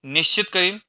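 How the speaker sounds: loud, high angry yell; angry shout. A narrator's reading. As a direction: fling it back at her in anger. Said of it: "मी ओरडलो". (संतापाने ओरडून)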